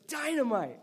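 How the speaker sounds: "dynamite" burst out hard on the first syllable; emphasis of dynamite. Shown as a demonstration. Pastor showing church how to read power, explosive "dynamite". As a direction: make the word "dynamite" explode. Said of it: "Read it with the emphasis of".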